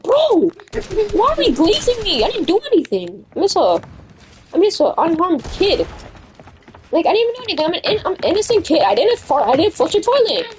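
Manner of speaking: high-pitched pleading denial; indignant protest. Retold or imitated. Accused kid telling teacher he didn't do it, high protest.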